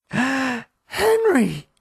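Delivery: high startled cry of a name; wife alarmed by the bug, gasping his name. A narrator's reading. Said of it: "gasped Maureen".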